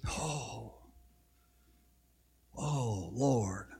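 very soft, low murmured cry; faint, reverent sigh of Lord; hushed prayerful oh. Real, not acted. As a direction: breathe it out to God. Preacher moved to a whisper.

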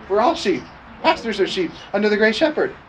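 ordinary delivery

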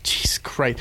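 whispered, stunned exclamation; hushed shock. Retold or imitated. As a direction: breathe it out in retold disbelief. Retells own reaction to scary footage.

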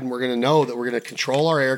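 usual speaking voice

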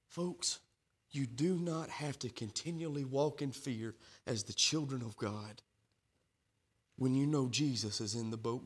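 low, soft, tender preaching; hushed reassuring preacher; soft sermon close. Acted. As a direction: comfort the frightened flock gently. Soft intimate scripture and comfort.

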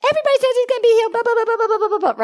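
high, fast mocking wife voice; angry wife. Preacher mocking the angry wife who scoffs at healing, high and fast. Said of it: "his wife got very angry".

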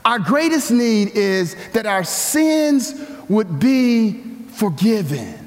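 ordinary talk

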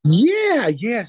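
bursts out high and loud; eager yes. Real, not acted. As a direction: jump at the chance to tell it.

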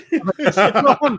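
high, laughing through the words; laughing at his own rant. Real, not acted. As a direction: crack up while telling. Laughing mid-retelling.

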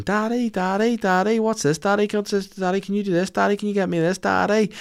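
put-on high, pleading kid voice calling Daddy; imitating a four-year-old. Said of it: "a four-year-old shouting".

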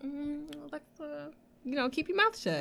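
high, soft murmur to oneself; hushed self-talk. Retold or imitated. Telling herself to stay out of it, hushed. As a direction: say it softly, as a private reminder. Silent inner note to self.

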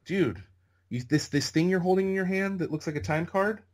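high-pitched, imitating an astonished extra; incredulous explaining. Extra explaining the SAG voucher to clueless newcomer, incredulous. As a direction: press the point in high disbelief.

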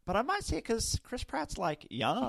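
put-on dreamy teen gush; valley girl voice. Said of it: "I'll never forget the words".

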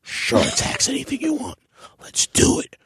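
airy, high, eager agreement. Retold or imitated. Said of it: "they was like".

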